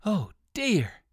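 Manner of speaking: mild storybook dismay; frightened cry. A narrator's reading. Rabbit sees the auto coming, mildly alarmed cry. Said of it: "cried Uncle Wiggily".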